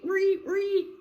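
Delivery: high piercing stab sound effects; shrill high reet reet stabbing sound; squeaky stab noises. Acted. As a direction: squeal out each stab like a horror film. Stabbing noises made vocally.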